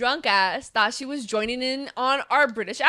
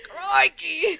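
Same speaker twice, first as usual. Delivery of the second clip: higher, mock-Australian exclamation; Australian accent; 5 semitones higher than usual. Friends joking they are Australian, mock Australian accent. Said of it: "apparently I'm Australian".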